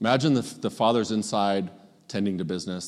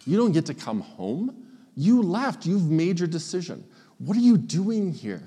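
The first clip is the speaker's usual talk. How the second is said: much higher, threatening rant; menacing older brother; 5 semitones higher than usual. Retold or imitated. Older brother confronting his returning younger brother, menacing and high. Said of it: "a far more threatening and menacing way".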